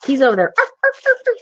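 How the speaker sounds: high yappy mock "arf arf"; imitating dog barks. Describing the dog in the bush, high mock barking. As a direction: bark like the excited dog. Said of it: "barking, barking".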